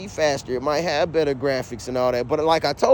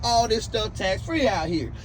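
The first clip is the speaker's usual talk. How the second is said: heated argument; 5 semitones higher, 5 dB quieter than usual. Retold or imitated. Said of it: "damn near shouting match".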